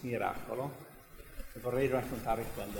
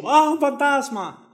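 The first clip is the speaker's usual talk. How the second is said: high, loud frightened cry; mock fright; 13 semitones higher, 13 dB louder than usual. Preacher acting the disciples seeing a ghost, high mock-frightened cry. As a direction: act out the disciples' ghost scare.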